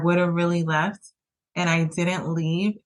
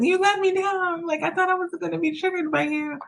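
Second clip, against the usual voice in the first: much higher, reproachful coach voice; disappointed coach; 12 semitones higher than usual. Retold or imitated. Telling her partner he let her down, in a coach's reproach. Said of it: "felt like telling my partner that".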